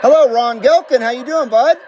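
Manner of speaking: a beaming, hammed-up friendly American hello. Shown as a demonstration. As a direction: greet him like an old radio buddy. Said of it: "my very friendly American voice".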